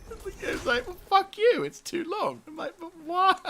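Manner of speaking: high, squealing protest through real laughs; helpless laughter. Real, not acted. Player baffled by the game's length limit, helplessly laughing. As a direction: whine the complaint while cracking up. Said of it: "I'm just laughing now".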